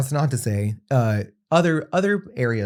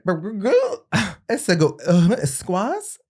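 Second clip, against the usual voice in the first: flustered stammer; 8 semitones higher, 3 dB louder, slower than usual. Retold or imitated. Acting out flustered reply.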